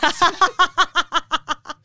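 loud high cackle. Real, not acted. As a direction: burst into a high cackling laugh. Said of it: "That was such a cackle".

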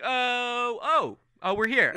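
high, loud oh cut short on arrival; caught mid-song. Acted. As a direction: break off as you realise you've arrived. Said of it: "he's like".

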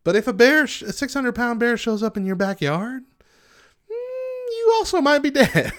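rising to a hammed-up squeal; mock dramatic wail. Mock dramatic bear warning.

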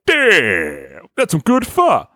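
drawn-out impressed exclamation, then high-pitched praise; hammed-up amazed approval; blaxploitation hero. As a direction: savor it like a seventies movie hero. Fro's reaction to the pho.

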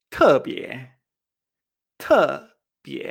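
"tebie" with a hard drop, then a lift; falling then rising tones. Shown as a demonstration. Teacher modelling special, "tebie" falling then rising. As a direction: knock "te" down and raise "bie". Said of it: "que tienen esta entonación".

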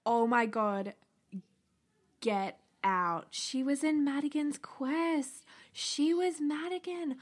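loud, high, slow gushing over Madigan's Quest; excited disbelief. Real, not acted. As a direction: gasp it out like a thrilled fan. Recognizes a childhood show's actress.

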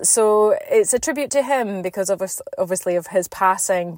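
usual speaking voice

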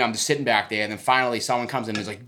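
ordinary talk, nothing marked

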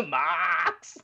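hammed-up high wail for a fallen friend; mock crying. Acting out a friend mock-crying Mox's name over his body.

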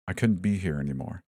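ordinary delivery, nothing marked

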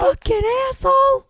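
high, loud hammed-up outburst; mock fury. Imagined Ana Lucia losing it at Michael, mock furious yell. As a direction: play at losing your temper.

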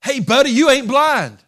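high, loud, fervent shouting; Bartimaeus preaching back. Acted. Blind beggar defying the crowd, shouting high and loud.

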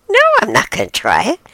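high, quick refusal; refusing raccoon. Acted. Second raccoon turning down the taste, high-pitched refusal. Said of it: "The other raccoon says".

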